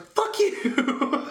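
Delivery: high, drawn-out yell breaking into laughter; comic exasperated outburst; escalated mock fury. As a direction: curse them out at full pitch. Caller repeats curse, escalated.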